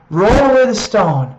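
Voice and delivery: loud ringing command, "away" hit hard; commanding call. Shown as a demonstration. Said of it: "when Jesus speaks that".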